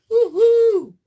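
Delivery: very high, loud woo-hoo; excited whoop. Real, not acted. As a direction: shout it with joy. Cheering on live.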